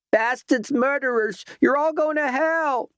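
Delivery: very high shrieked curses, woman at the end of her rope; shrieking woman. A narrator's reading. Disheveled woman shrieking at a picket, very high hysterical shriek. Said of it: "was shrieking at a picket".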